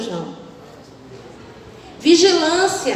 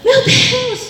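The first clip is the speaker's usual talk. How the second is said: indignant plea; 5 semitones higher than usual. Retold or imitated. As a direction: ham up an offended prophet.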